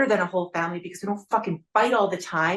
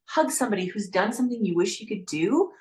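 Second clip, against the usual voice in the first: ordinary delivery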